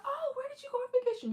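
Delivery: high, fast chirpy coworker voice; coworker asking. Retold or imitated. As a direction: rattle it off like a perky coworker. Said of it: "your coworker's like".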